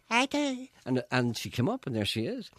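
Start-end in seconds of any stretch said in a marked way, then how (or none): 0.1-0.7 high chirpy reply; eager girl. Retold or imitated. The girl answering the producers, high and eager. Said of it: "And the girl went".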